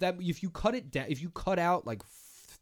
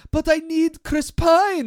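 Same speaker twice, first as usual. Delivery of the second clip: put-on high, slow, loud Israeli accent; 13 semitones higher, 10 dB louder, slower than usual. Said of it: "It's a great Israeli accent".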